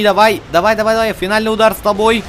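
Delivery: usual speaking voice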